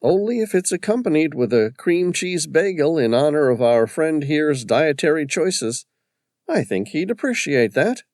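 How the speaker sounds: lightly voiced partner, deadpan joke; character voice. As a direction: tease with a straight face. Partner jokes about bagels.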